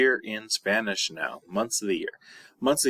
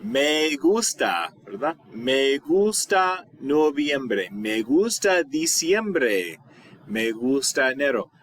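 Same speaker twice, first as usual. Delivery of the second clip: "gusta" stretched out slowly and high; slow model answer; 4 semitones higher, slower than usual. Shown as a demonstration. Teacher modelling the favorite-month answer slowly. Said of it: "How would you say that?".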